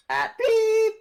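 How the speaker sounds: high loud beep sound; censor bleep. Acted. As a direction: bleep over the word.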